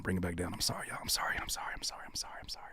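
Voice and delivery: soft unvoiced whisper; hushed sorry after losing his temper; whispered apology. Real, not acted. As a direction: whisper it, calming yourself down.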